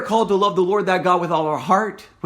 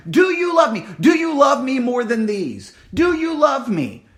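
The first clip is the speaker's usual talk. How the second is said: higher, louder pleading question; Jesus pressing Peter, louder each time; insistent calling; 5 semitones higher, 3 dB louder than usual. Acted. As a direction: press him with each ask. Preacher voices Jesus insistently.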